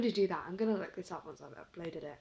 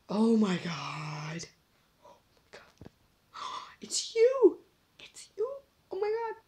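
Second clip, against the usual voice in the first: gasp, then high whispered It's you; hammed-up discovery of a lookalike; mock amazed gasp; 3 semitones higher, 8 dB louder, slower than usual. Pretends mum is the blob.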